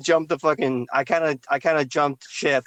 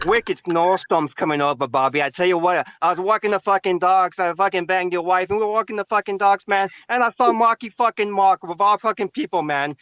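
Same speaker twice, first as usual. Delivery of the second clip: put-on higher, brash Boston accent in a rambling bit; 6 semitones higher than usual. Friend riffing a Boston guy for the podcast crew, in a Boston accent. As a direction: lay on a thick Boston accent like a local telling a story. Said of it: "That's actually pretty good".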